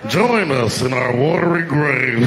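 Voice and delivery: ghostly dead voice. Pretend. Drowned Titanic passengers calling to the submersible, slow eerie ghost moan. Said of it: "this is my impression".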